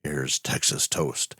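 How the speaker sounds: honey-smooth radio host introducing the next tale; velvet radio voice. Acted. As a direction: croon it like a late-night velvet-voiced announcer. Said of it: "call him Velvet Voice Vernon, and you'll see why".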